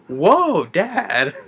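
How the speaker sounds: high, mock amazed kid; a kid impressed by his dad's punch. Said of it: "the kid is like".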